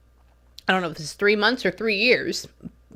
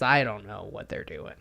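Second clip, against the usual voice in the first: voicing the spirit man; 11 semitones lower, 8 dB quieter than usual. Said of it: "Why do I make him sound like that?".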